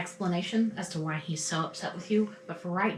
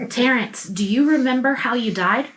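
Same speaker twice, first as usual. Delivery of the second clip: louder, higher call to get his attention; 6 semitones higher, 9 dB louder than usual. Acted. Miranda calling out to the dazed ghost, raised voice. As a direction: call his name to snap him out of it.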